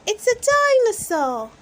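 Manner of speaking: high, loud correcting shout; high excited little-girl shout; Peppa shouting. A narrator's reading. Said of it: "shouts Peppa".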